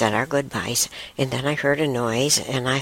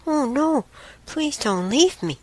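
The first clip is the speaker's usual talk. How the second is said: retold plea, pitched much higher; high, imploring quote; upset pleading; 13 semitones higher than usual. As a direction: act out begging him to stay. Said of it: "And I got upset".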